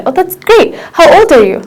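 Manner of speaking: normal talking voice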